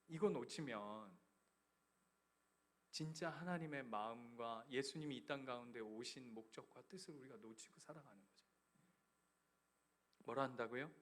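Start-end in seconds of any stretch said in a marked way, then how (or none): none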